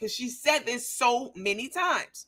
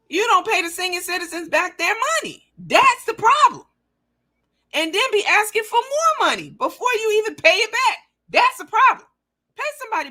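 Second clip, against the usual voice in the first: much higher and louder, hammering the point; indignant rant; 13 semitones higher, 5 dB louder than usual. Real, not acted. Ranting at the rival about unpaid seniors, outraged. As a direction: pound it home, outraged.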